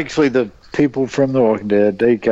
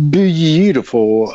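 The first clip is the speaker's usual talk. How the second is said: BEAUTIFUL drawn out slow and higher; admiring emphasis; 5 semitones higher, much slower than usual. Real, not acted. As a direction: stretch out the word BEAUTIFUL in admiration. Admiringly describing the optometrist.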